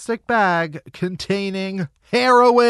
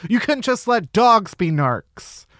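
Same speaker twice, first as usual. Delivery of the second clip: normal talking voice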